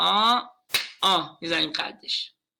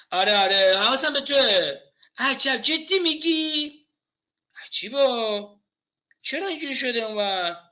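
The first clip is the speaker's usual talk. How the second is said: distracted listener; 4 semitones higher than usual. Shown as a demonstration. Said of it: "یعنی اینجوری".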